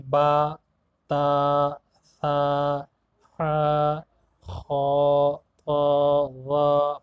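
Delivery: با تا ثا حا خا طا وا said in Arabic style with long open a; teacher demonstrating Arabic letters to children; Arabic letter names. Shown as a demonstration. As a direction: say each letter the Arabic way, drawn out. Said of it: "ہرگز نہ پڑھیں، بلکہ".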